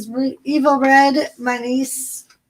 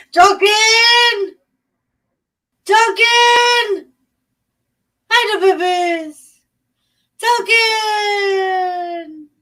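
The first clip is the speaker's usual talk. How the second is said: high, loud calls across the yard; calling the dog; 9 semitones higher, 5 dB louder, much slower than usual. Real, not acted. Calling out loud for her dog Duncan. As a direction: shout the dog's name to find him.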